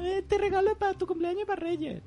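high, sing-song fake excuse; mock relative voice. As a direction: play the cheap relative brushing it off. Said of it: "La típica excusa".

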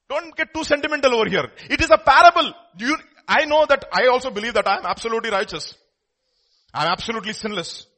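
high, loud preacher voicing Jesus; full-force preaching. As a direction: admonish the crowd at full volume.

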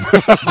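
quick burst of laughter; short laugh. Real, not acted. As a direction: laugh at your own joke. Laughs at the joke.

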